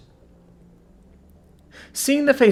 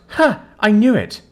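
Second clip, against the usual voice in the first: high, loud gloating Ha; triumphant shout; 7 semitones higher, 4 dB louder than usual. A narrator's reading. To Victor after the vendor's answer: triumphant shout. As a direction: crow at him, you caught him out. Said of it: "I turn to Victor and shout".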